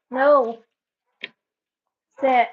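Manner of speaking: sharp loud shout of a dog command; loud firm command. Real, not acted. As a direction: bark the order at the dog so he obeys.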